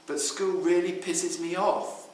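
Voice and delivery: a pupil telling her counsellor she is fed up; exasperated. Retold or imitated. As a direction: vent about school, annoyed. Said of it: "she says".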